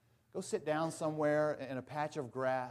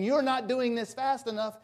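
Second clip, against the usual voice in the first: higher, loud bratty complaint; yelling spoiled girl; 8 semitones higher, 6 dB louder than usual. Retold or imitated. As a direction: whine at daddy to hurry. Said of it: "when she's yelling at him".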